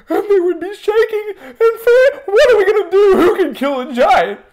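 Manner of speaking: high, quavering panicky voice; hammed-up trembling squeal; mock scared. Soldiers shaking in their tent over the giant, comically scared. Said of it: "they would get scared".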